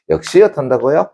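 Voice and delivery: ordinary talk, nothing marked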